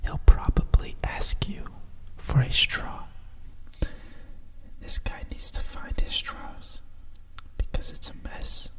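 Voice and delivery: usual speaking voice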